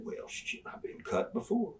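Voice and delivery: hushed whisper, almost no voice; whispered retort. Retold or imitated. Wrestler answering Von Erich's warning, whispered. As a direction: toss it off under your breath.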